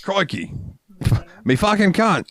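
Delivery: high, hammed-up Australian accent. Shown as a demonstration. Host trying a little Australian, high accent. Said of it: "I can do a little bit of Australian".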